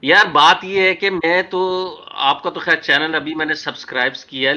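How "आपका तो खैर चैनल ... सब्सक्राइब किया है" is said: usual speaking voice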